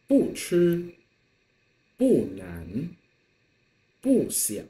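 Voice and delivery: sharp falling 不 before each word; teacher's model of falling 不; 不 in fourth tone. Shown as a demonstration. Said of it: "you read them all".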